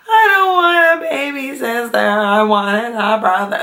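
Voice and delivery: high mock wail sliding downward; imitated full-on sob. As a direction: imitate a small child bawling in protest. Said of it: "full-on sob of".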